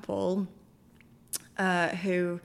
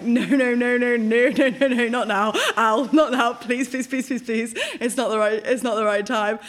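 urgent, rapid-fire repeats, raised and loud; panicked pleading; 3 semitones higher, 5 dB louder than usual. Retold or imitated. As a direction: act out frantically begging yourself not to cry. Pleading with her own rising tears.

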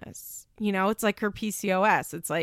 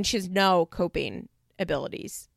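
ordinary talk

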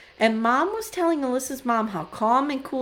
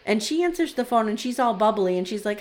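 normal talking voice

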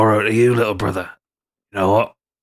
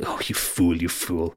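low, softer tutting; soft scolding; 6 semitones lower, 6 dB quieter than usual. Acted. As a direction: shake your head over him. Character scolding softly.

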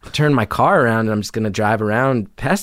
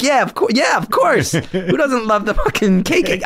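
much higher, eager fake excitement; mock enthusiasm; 10 semitones higher than usual. Narrator fakes enthusiasm for the show to calm the driver, eager high voice. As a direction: gush like a fan to win him over. Fake agreement to appease attacker.

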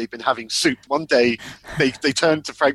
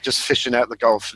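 normal talking voice

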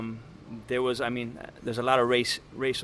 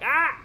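short high-pitched scream; sudden "ah" screamed out high; loud scream; 13 semitones higher than usual. Shown as a demonstration. Kid screaming over a bad word so mom wouldn't hear, high scream. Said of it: "just scream out loud".

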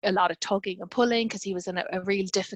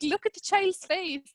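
mock scolding; 10 semitones higher than usual. Mock scolding herself and colleagues.

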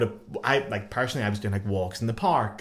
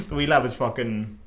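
ordinary talk, nothing marked

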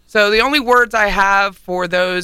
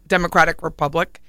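ordinary delivery

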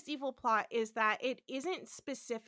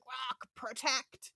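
strangled croak; 4 dB quieter, much slower than usual. Retold or imitated. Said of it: "'cause he's just been kinda strangled".

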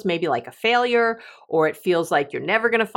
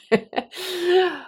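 light high laughter; 12 semitones higher than usual. Real, not acted. Host still amused by her own joke, laughing high. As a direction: let the giggle bubble up.

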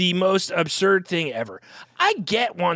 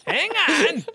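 high, raised objection; mock protest; 11 semitones higher, slower than usual. Host objects to joke about their group breaking up, mock protest.

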